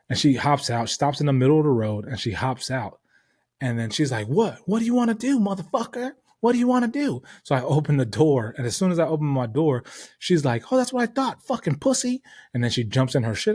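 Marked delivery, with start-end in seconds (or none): 4.3-7.2 high-pitched, confrontational imitation; mock angry woman. Imitating the driver who hopped out to confront him, mock angry woman. 10.7-12.2 very high-pitched jeering imitation; mock taunting woman. Imitating the woman driver as he opens his door, mock taunting woman.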